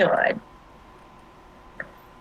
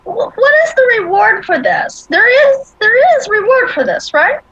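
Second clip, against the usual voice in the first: eager; 9 semitones higher than usual. Acted. Player asks goblin about reward.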